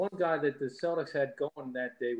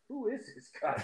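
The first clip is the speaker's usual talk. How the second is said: higher-pitched imitation of an alarmed crowd; a touch of put-on fright; mock terrified; 6 semitones higher, 4 dB quieter than usual. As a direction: act out startled onlookers, lightly. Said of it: "turned around with a terrified".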